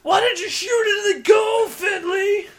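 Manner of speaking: high, loud heckling shout; mock sideline commentator. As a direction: yell it like an outraged armchair expert. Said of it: "all the things I've heard from people commentating".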